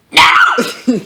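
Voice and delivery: crude laugh setup. Shown as a demonstration. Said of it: "so I need to be like".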